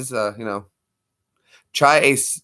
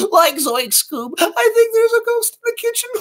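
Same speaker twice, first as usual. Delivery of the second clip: very high cracking cartoon stoner; high panicky Shaggy whine. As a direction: squeak it like a scared Shaggy. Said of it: "Scooby-Doo".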